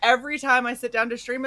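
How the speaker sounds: ordinary talk, nothing marked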